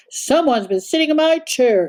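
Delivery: mild exclaim, lightly voiced father bear; Papa Bear finds his chair used, light exclaim. A narrator's reading. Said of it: "exclaimed Papa Bear".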